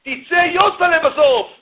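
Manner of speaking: high, slow scolding warning; scolding father. Retold or imitated. Father scolds his son, slow high warning. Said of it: "האבא צועק על הבן שלו".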